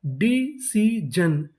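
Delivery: Decision very slow as डी, सी, जन; syllable by syllable. Shown as a demonstration. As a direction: sound out decision with gaps between syllables. Teacher sounding out new word.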